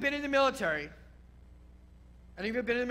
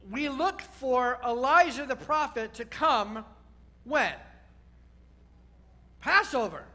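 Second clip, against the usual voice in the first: ordinary talk, nothing marked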